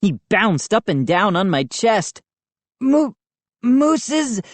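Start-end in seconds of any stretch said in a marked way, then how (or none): 2.8-4.4 wheezed. A narrator's reading. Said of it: "I wheezed".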